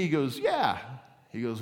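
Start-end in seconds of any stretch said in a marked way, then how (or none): 0.4-0.8 high, loud parrot reply; sharp rise into parrot voice; parrot squawk. Acted. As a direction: squawk back as a joke parrot. Parrot answers customer in joke.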